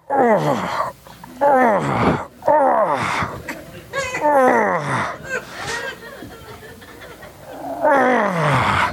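imitated wailing moans, falling in pitch; pained groans. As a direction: groan in mock agony, one moan after another. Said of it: "Kevin's going".

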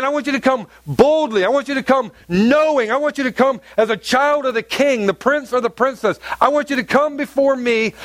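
high, urgent preaching as God speaking; voicing God. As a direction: lift it up and plead boldly as God. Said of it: "He's saying".

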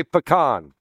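"pecan" as puh-KAHN; puh-KAHN pronunciation. Shown as a demonstration. Preacher repeating his poll to the church, "pecan" as puh-KAHN. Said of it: "how many say".

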